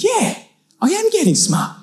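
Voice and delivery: very high-pitched mock defiance; cheeky comeback. Young cousin answers back to a stranger, cheeky and high.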